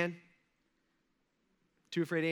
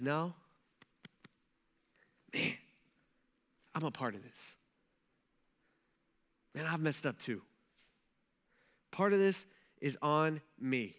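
humble confession; 4 semitones lower, 5 dB quieter than usual. Retold or imitated. Daniel admits his guilt.

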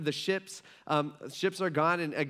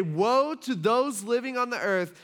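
raised, higher proclaiming voice; shouted warning; 7 semitones higher, 4 dB louder than usual. Retold or imitated. Someone in heaven crying woe to earth's people, shouted. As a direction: call it out as a warning from above. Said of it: "they shout out".

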